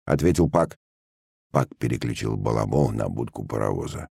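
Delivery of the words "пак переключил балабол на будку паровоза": ordinary delivery, nothing marked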